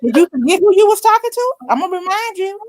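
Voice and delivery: high-pitched mock maternal threat; scolding mother. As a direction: imitate mom putting her daughter in place. Mother scolding upset daughter, retold.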